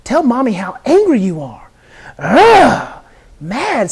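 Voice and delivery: loud, high mock-angry venting; voicing a child's anger. Shown as a demonstration. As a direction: echo the boy's anger loudly.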